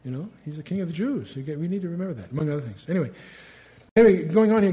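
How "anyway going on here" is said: ordinary delivery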